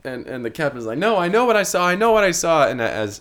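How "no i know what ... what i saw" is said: high, loud repeated insistence; captain shouting at the first mate, stubborn; screaming insistence. Acted. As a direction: yell it like nobody believes you. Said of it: "as he's screaming about knowing what he saw".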